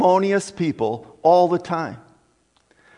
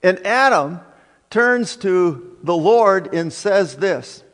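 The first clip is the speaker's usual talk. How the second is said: ordinary talk, nothing marked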